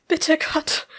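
high, loud, gasping prayer; gasped plea. A narrator's reading. Bleeding woman begging God, gasping. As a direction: gasp out a desperate plea. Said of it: "keuchte sie".

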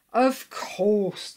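bright-idea outburst; delighted realisation. A narrator's reading. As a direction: exclaim as if it just clicked. Willie suddenly remembers the answer.

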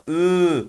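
ü drawn out long, slow; elongated vowel. Shown as a demonstration. Teacher drilling students, stretching ü long. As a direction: stretch the ü as the vav lengthens it. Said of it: "Vav alınca".

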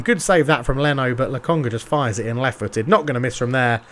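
lightly done play-by-play commentator voice; TV football commentary. Acted. Gamer narrating his FIFA match to viewers, light commentator style. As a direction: call the match like a TV pundit.

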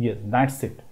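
normal talking voice

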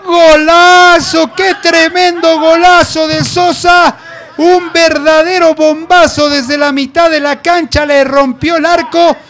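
screaming goal call, long stretched vowels; breakneck goal commentary. Real, not acted. Goal scored, live call.

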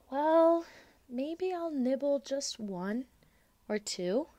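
high, drawn-out reluctant giving in; hesitant child. Acted. Lola gives in to the green drops, slowly. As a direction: stretch it out, half-willing.

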